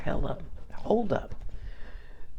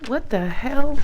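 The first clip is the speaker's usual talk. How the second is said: higher, louder startled outburst; what the hell; 7 semitones higher, 5 dB louder than usual. Real, not acted. Owner reacting to her dog, startled outburst. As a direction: react to the dog, caught off guard.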